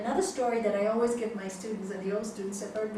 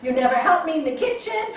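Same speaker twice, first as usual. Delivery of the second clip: telling off; 5 semitones higher, 10 dB louder, faster than usual. Retold or imitated. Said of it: "I would tell him off".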